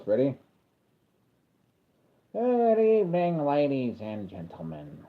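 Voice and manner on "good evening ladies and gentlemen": Joker. Retold or imitated. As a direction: announce it like a theatrical Joker. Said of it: "I'm gonna do Joker voice".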